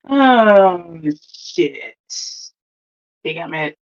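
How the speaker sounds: slow sinking into near-silence; retold defeated muttering, with long pauses; deflated dismay. Retells dismay at the tragic turn.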